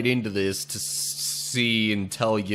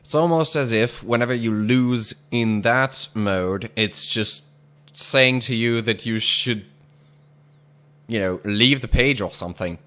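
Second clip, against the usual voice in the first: ordinary talk, nothing marked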